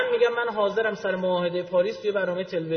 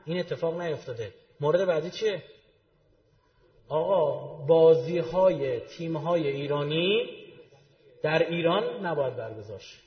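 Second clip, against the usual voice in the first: ordinary talk, nothing marked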